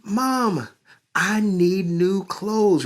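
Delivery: put-on high, pleading boy's voice, slow; child crying out. Boy begging his mother for new clothes, high and whiny. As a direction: beg mom like a whining kid. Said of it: "Rigo cried".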